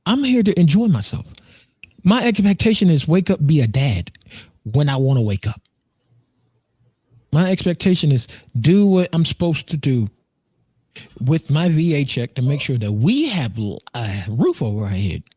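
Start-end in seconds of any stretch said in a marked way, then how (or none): none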